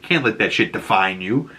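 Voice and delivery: usual speaking voice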